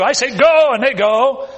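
"Go" barked out loud and high; commanding Go. Shown as a demonstration. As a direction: snap out "Go" like an order. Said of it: "I speak words too".

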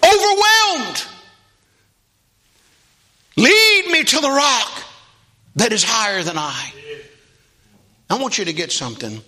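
high, loud, intoned preaching; climbing preacher's chant; full-force preaching. Acted. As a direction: whoop it up to the congregation.

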